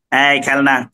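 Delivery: level-toned street vendor calling out wares; monotone sales cry; toy hawker's call. Acted. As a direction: call out toys like a roadside seller. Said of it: "খেলনা বিক্রি করছে এইভাবে".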